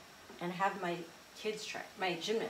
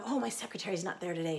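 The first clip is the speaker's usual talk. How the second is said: usual speaking voice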